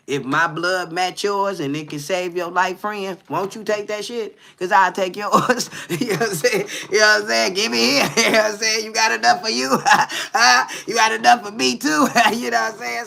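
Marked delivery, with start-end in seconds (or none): 4.6-13.1 loud, giggly joking through laughs; laughing banter. Real, not acted. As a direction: laugh while teasing the friend. Joking about sharing blood, laughing.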